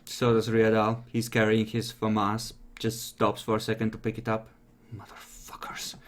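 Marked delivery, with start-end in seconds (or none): 4.9-5.9 very soft, voiceless swearing to oneself; whispered curse. Acted. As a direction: curse under the breath in a whisper. Game master voices angry character cursing.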